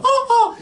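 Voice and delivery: very high, loud cartoon penguin honk; Pingu squawk. Comedian imitating Pingu's squawk, very high and loud. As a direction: squeak it out like Pingu. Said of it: "sound like Pingu, go".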